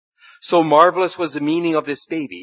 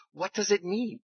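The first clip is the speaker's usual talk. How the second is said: anxious thought; 6 semitones higher than usual. Acted. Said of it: "she thought".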